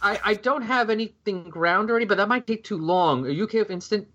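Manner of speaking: high, airy and breezy; breezy matter-of-fact. Retold or imitated. Imitating Diane brushing off the police, breezy. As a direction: brush it off lightly. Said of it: "she plays it off perfectly".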